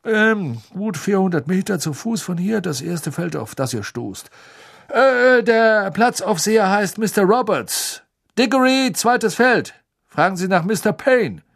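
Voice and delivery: high, loud official voice reading out the directions; a harried clerk calling out places from a list; high, loud, harried campsite clerk; weary official reads. A narrator's reading.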